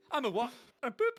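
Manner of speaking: laughing, squeaky high parody of the film line; giggly mock Hagrid and Harry exchange; silly Harry Potter parody.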